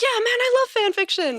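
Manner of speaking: a hammed-up, high-pitched take on her cohost; high mock imitation of Ralph. Teasing her cohost, hammed-up high imitation of him. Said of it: "You were like".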